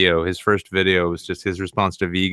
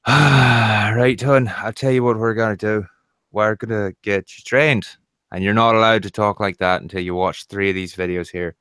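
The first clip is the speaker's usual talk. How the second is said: put-on louder, sighing Scottish lecture to a child; Scottish parent voice; 3 dB louder than usual. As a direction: scold her kindly but firmly, Scottish. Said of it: "and I'll just be like".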